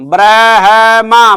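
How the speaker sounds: ब्रह्मा drawn out slowly, each sound clear; slow careful spelling-out. Shown as a demonstration. Teacher sounding out ब्रह्मा slowly for students. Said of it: "ये होता है ब्रह्मा".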